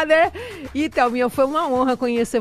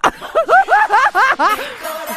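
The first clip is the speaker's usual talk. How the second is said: loud high laugh; 12 semitones higher, 5 dB louder than usual. Real, not acted. Host cracking up at her own threat on air, loud high laugh.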